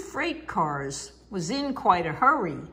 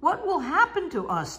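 high, fast worried question; a touch of anxious passengers; worried people; 8 semitones higher, faster than usual. Acted. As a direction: fret about being stranded. Stranded people worrying.

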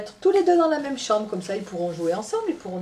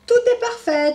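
high, loud confident verdict; doctor declaring; 9 dB louder than usual. Acted. Doctor announcing to Tom after examining him, high and loud. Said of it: "déclare-t-il".

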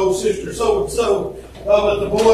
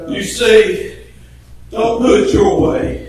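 usual speaking voice

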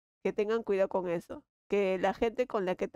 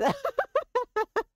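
a high squeaky laugh; 3 dB louder than usual. Real, not acted. Laughing at own joke. (risa chillona)

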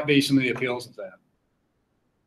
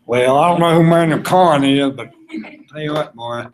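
most Southern accent possible; 3 dB louder than usual. Shown as a demonstration. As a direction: lay the Southern accent on thick. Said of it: "in the most southern accent possible".

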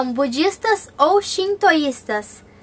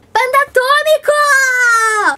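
excited call; 8 semitones higher, 5 dB louder, slower than usual. Acted. Calling out next question name.

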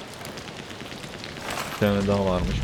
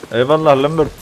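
loud, high grateful shout; excited thanks; 5 semitones higher, 7 dB louder than usual. Real, not acted. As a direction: thank him with a burst of relief. (coşkuyla teşekkür ederek)

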